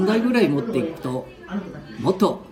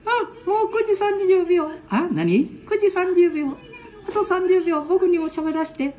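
put-on high, playful little-boy voice; squeaky grandkid voice; child voice. Ken-chan interrupts grandpa.

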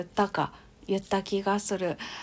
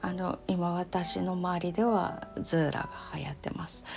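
whispering; 2 semitones lower, 8 dB quieter than usual. Real, not acted. Whispered aside.

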